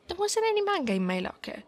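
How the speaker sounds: quick, high, startled blurt; alarmed realization. Acted.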